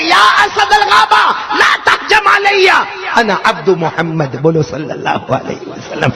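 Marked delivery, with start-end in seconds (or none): 0.0-4.4 high, slow, loud Arabic command; addressing the lion with a high, slow, loud cry; bold shout to the lion. Acted. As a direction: call out fearlessly. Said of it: "وہ شیر کو کہہ رہے".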